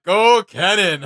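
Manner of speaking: drawn-out, loud cheer for a champion; rooting hard from the sidelines, stretched out. Pretend. Imagined cheering for Kennen.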